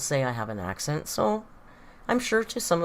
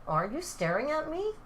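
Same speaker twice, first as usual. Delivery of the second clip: pet-talk voice; 11 semitones higher than usual. Real, not acted. Streamer to the watching cat, high pet-talk voice.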